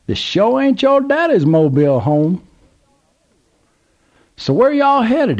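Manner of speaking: put-on high, excited drawl of an old country man; old Southern gentleman. A narrator's reading. Old man calls to trucker, high Southern voice. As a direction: play the curious old man at the gas pump.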